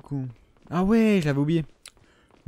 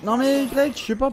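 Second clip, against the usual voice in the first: frustrated yell; 12 semitones higher than usual. Real, not acted. Gamer yelling at a teammate's move, exasperated. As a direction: yell in disbelief.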